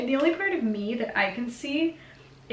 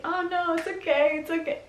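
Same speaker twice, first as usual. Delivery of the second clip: Harvey reassuring; 7 semitones higher than usual. Retold or imitated. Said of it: "he's like".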